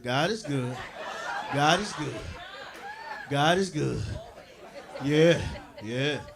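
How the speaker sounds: low, soft crowd settling; calm. Real, not acted.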